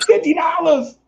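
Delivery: shrieking high imitation of her shock; high, loud mock outrage at the price; shocked woman. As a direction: squeal it like she can't believe the price. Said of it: "And she's like".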